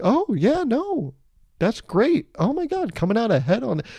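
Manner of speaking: very high, gushing mock delight; delighted passenger. Passenger offered a cruise discount, high mock delight. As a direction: squeal your approval, hammed-up.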